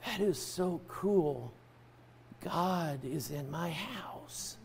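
very soft, slow retold wonder; hushed awe. Preacher recalling himself as a little child hearing God, hushed awe. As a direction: breathe it slowly, like a child marveling in secret.

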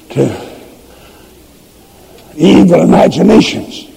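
ordinary delivery

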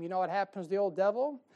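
normal talking voice

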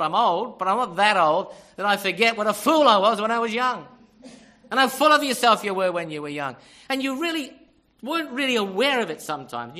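loud, insistent pulpit delivery; forceful preaching. Real, not acted. Older preacher admits youthful folly to congregation, forcefully. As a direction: preach it out at full voice.

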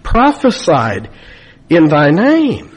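false prophets protesting at judgment, rising plea; pleading complaint. Retold or imitated. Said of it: "Look at their complaint".

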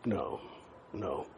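soft, low no, no, no; hushed, low repeated no; quiet refusal. Retold or imitated. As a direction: breathe the refusals almost silently. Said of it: "and I'll say".

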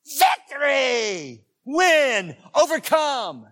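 loud, high triumphant proclamation; full-force preaching. Real, not acted. Preacher rousing Christians to victory, full-force shout. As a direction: shout it out to rally the crowd.

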